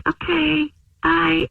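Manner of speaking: high, sing-out mock farewell; re-enacted awkward little bye; awkward goodbye. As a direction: pitch it up for a sheepish exit. Caller re-enacts her awkward morning exit.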